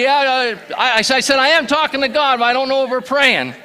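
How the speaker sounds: pushing the words out, half laughing; animated retelling. Retold or imitated. Excited retelling to pastor.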